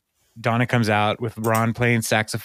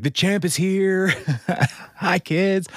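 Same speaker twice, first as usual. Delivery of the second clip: higher, slow mock wrestler boast; imitating John Cena; 8 semitones higher, slower than usual. John Cena greeting the kids, mock wrestler boast. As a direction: hype the kids up as a showman. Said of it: "he's like".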